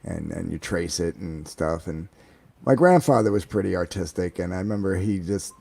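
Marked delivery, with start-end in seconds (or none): none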